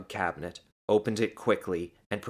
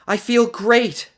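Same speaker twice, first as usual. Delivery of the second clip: high, loud, drawn-out joy; elated exclamation; 10 dB louder, slower than usual. A narrator's reading. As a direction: burst out exhilarated. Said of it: "she exclaimed".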